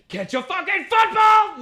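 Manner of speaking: huge high mock yell of an angry dad. Dad yelling at his kid outside, high mock yell.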